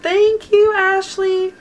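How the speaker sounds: high, sing-out thank you; grateful gushing. Real, not acted. Vlogger thanking Ashley for loving her videos, grateful gushing.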